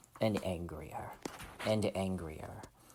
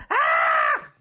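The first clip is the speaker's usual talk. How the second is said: scientists' scream; 11 semitones lower, 8 dB louder than usual. Acted. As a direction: scream like a roomful of panicked scientists. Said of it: "all the scientists are".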